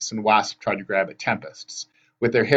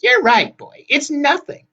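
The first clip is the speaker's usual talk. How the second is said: put-on high, loud old sister; backtracking hag; 6 dB louder, slower than usual. A narrator's reading. Hag hastily backs down.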